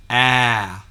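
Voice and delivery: "ah" as a loud apple vowel, mouth wide open; loud, over-articulated "ah" with the short A of apple; exaggerated short A. Shown as a demonstration. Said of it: "It doesn't hurt to exaggerate".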